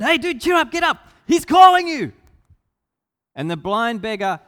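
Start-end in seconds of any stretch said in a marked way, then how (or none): 0.0-2.1 loud, high, urging shout; excited calling out. Acted. Crowd tells the blind beggar Jesus calls, excitedly shouting. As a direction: rouse him with a high, loud call.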